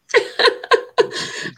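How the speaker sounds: high-pitched laugh breaking into crying; tearful laugh. Real, not acted. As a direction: laugh through real tears. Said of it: "I'm so emotional".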